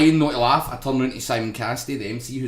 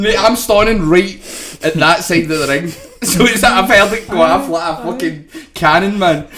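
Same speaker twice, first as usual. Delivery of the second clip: louder, through laughs; laughing; 5 semitones higher, 9 dB louder than usual. Real, not acted. Telling friends about the cannon fart, laughing. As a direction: still cracking up.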